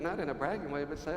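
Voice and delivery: ordinary talk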